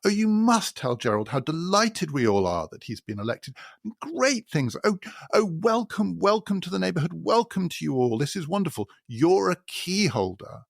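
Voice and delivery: high, gushing upper-class delight; suddenly thrilled snob welcoming newcomers; effusive high society gush; gushing posh neighbour. Retold or imitated. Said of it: "this man goes".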